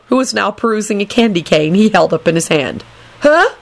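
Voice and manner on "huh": high, vague grunt; reply without looking up; absent-minded. A narrator's reading. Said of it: "he replied without looking up".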